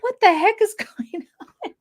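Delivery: high, laughing what-the-heck; laughing mock disbelief. Mariner baffled by the mission, laughing high. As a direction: squeal it through a laugh. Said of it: "Mariner's all like".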